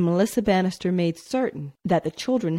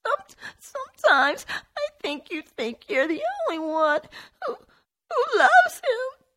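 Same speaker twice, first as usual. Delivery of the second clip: much higher, faltering voice cracking into crying; breaking into tears. A narrator's reading. Jennifer scolds Tony through tears, voice breaking into crying. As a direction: stumble and break into a sob at the end. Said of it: "trembling words".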